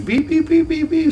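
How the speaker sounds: beeping alarm. Retold or imitated. Imitating truck backup alarm.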